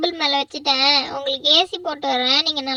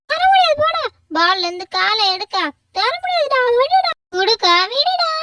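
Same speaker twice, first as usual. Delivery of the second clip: put-on higher, louder childish tug-of-war yelling; squabbling shout; 7 semitones higher, 3 dB louder than usual. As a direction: fight for the ball, snapping back. Siblings fight over football.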